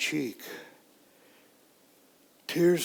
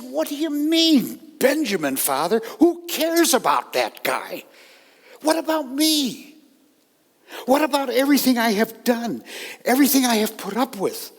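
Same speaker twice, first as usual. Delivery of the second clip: louder and much higher, a hurt outburst gathering speed at the end; shouting at father; 8 semitones higher, 5 dB louder than usual. Acted. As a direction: yell your hurt at your father. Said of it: "I shouted at my father".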